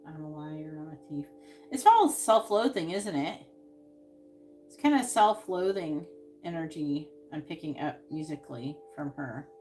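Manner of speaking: normal talking voice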